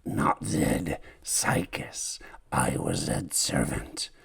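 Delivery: hoarse, breathless whisper; dying whisper. Acted. Dying servant whispers his name to the hero. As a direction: barely get the words out, dying. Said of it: "whispers the man".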